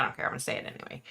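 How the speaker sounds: breathy whisper, voice dropped right down; whispered aside. Real, not acted. Vlogger defiantly admits an unpopular view to her viewers, whispered. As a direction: let the words slip out under your breath.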